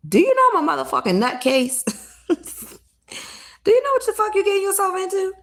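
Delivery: half-joking, crank it up to a frantic warning; mock warning to a suitor. Warning a man who wants marriage, rising frantic mock shout.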